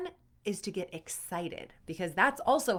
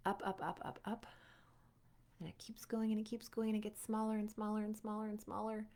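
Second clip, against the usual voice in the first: soft, even, drifting away; soft trailing-off; 2 semitones higher, 8 dB quieter than usual. Acted. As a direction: let it float off softly, smaller and smaller. Guiding a balloon visualization.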